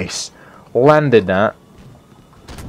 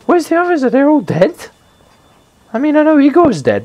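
incredulous, very high-pitched demand; high, exasperated gamer complaint; high-pitched dismay. Real, not acted. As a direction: wail it high, missing your teammates. Left alone, teammates missing in game.

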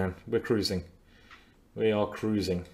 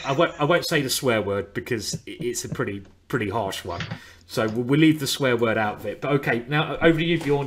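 ordinary talk, nothing marked

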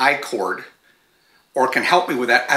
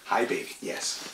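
cooing to a pet; 5 semitones lower, 13 dB quieter than usual. Real, not acted. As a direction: greet the dog tenderly, barely voiced. Cooing to a dog on lap.

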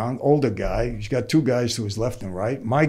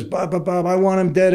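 pitched-up, agitated retelling of a mobster's rant; mock angry rant; 6 semitones higher than usual. Mario complaining to Mike about Jerry, raised agitated rant. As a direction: play the aggrieved mobster, worked up but retold. Mob boss insisting on the killing.

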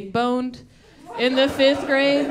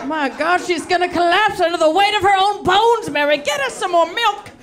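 much higher, louder hearty doctor voice; hearty shouting doctor; 7 semitones higher, 5 dB louder than usual. Retold or imitated. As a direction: boom it out like a jolly country doctor. Doctor continues loudly.

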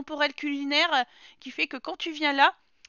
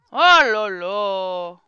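very slow, loud exasperated groan; long dramatic sigh of despair; drawn-out oh là là; 5 semitones lower, 7 dB louder, much slower than usual. Real, not acted. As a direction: stretch it out, fed up. Sim makes a mess.

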